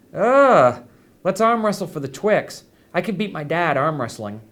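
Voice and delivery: put-on high, loud whiny little boy; a kid groaning in disgust at a radish; whiny child. A narrator's reading. First grader refusing the nurse's radish, whining loudly.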